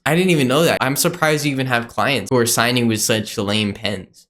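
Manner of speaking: ordinary talk, nothing marked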